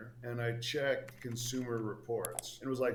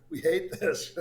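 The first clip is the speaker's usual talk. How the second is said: mock thieves; 9 semitones higher, 12 dB louder, slower than usual. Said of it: "They just got in and went".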